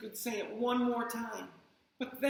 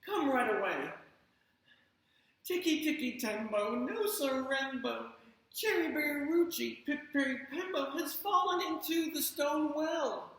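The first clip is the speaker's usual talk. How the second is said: urgent plea; 6 semitones higher than usual. Acted. Chang urges old man.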